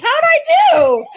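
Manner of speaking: put-on high, loud, eager kid voice; delighted child imitation. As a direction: pipe up like an excited little boy. Child actor pops up delighted.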